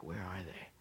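mock suspicious whisper. Imagined search for hidden wolves.

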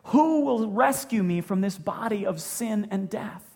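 slow, loud anguished plea for rescue; crying out. Retold or imitated. Preacher voicing Paul's plea for rescue, slow loud cry. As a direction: cry it out slowly like a desperate prayer. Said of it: "Paul just cries out and he says".

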